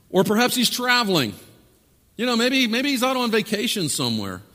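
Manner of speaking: high-pitched mock helpfulness; taunting. Elijah mocking the absent god, taunting. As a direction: offer mock excuses to goad them. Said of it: "Elijah began to taunt them".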